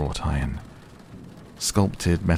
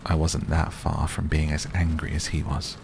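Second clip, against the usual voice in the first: usual speaking voice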